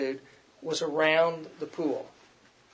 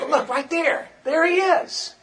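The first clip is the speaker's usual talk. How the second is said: much higher, thrilled pointing out a celebrity; excited spotting; 10 semitones higher, 4 dB louder, slower than usual. Pretend. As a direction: gasp out the star's arrival with delight. Spotting a star in crowd.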